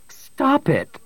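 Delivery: sharp leap up in pitch; crying out. A narrator's reading. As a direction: cry out to make him stop. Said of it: "Bria cried".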